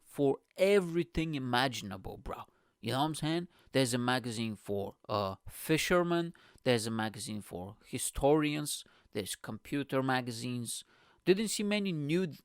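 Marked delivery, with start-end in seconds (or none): none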